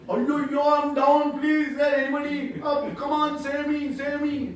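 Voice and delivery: full-throated cry at the top of the voice; shouting for help. Shown as a demonstration. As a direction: scream for rescue from the bottom of a pit. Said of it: "Top of the voice, as much as possible".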